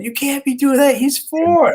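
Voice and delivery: high-pitched mock outraged wife; hammed-up high wife voice scolding husband; angry wife imitation. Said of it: "there was steam from Jack's ears".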